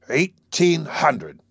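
slow, drawn-out figuring; calculating aloud. Acted. Wolf Larsen reckoning Maud's salary, slowly calculating. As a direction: work the sum out as you say it. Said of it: "he calculated".

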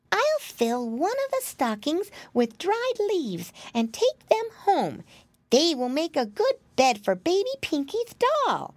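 put-on high, childlike piglet voice; high-pitched piggy boy, unhurried; Flop Ear. A narrator's reading. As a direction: think aloud as a little pig. Piglet thinks to himself.

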